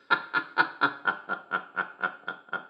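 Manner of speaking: soft, long staccato laugh; helpless chuckle. Acted. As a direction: chuckle on quietly in a rattle. Laughs at punchline.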